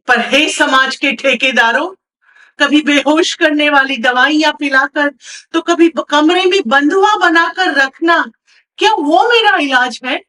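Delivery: loud, rising indignant declamation; impassioned accusation. Acted. Patient confronting society over being confined, accusing loudly.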